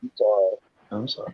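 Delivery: talking without teeth in; soft words with teeth out; loose dentures. Real, not acted. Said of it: "I need to put my teeth in so I can talk right".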